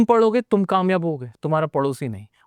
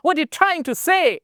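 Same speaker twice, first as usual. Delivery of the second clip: high, drawn-out put-on foreign accent; mock foreign English accent; 11 semitones higher, much slower than usual. Shown as a demonstration. Teacher showing students what not to copy, mock foreign accent. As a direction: exaggerate a fake foreign accent, stretched and high. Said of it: "ना British, ऐसे भी नहीं बोला".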